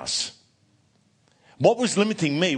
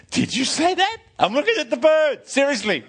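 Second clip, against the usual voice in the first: pitched way up in disbelief; shocked question, much higher; astonished; 10 semitones higher than usual. Retold or imitated. Startled at a talking bird.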